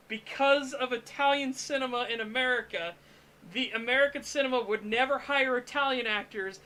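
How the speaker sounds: ordinary talk, nothing marked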